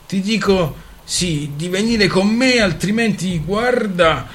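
stern uncle shouting at his niece. Acted. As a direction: warn her, voice rising to a yell. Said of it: "gridò Isai".

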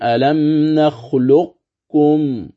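slow recited alam nakhluqkum, qalqala dropped; Quran recitation demo. Shown as a demonstration. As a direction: recite ألم نخلقكم slowly with merged qaf and kaf. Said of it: "فتذهب صفة قلقلة".

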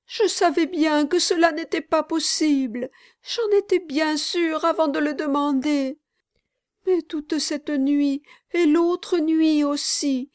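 a child confessing a bad dream; high, mournful little voice; small child.

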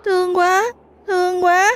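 put-on high, faint little child repeating; a ghost child's small whisper-like murmur; gentle child spirit echoing in his head; soft child's voice. A narrator's reading. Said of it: "giọng nói khe khẽ của trẻ con".

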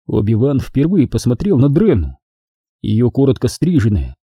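plain reading voice

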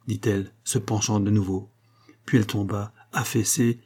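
ordinary delivery

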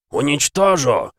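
медленный громкий угрожающий выкрик; грозить, вкладывая всю ярость; яростный крик. Acted. Бог обрушивает клинок. (яростный крик)

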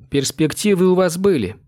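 cutting retort. A narrator's reading. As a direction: cut him off with a firm verdict. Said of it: "отрезала она".

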